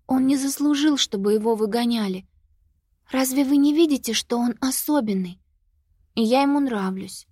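put-on high, earnest child's plea; pleading girl. A narrator's reading. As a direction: defend him like a child. Sophie defends the monster.

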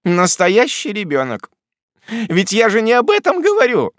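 high, exasperated cry; impatient exclaim. A narrator's reading. Miss Betsey exasperated by the widow's answer, impatient cry. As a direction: throw up your hands at her. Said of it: "нетерпеливо воскликнула мисс Бетси".

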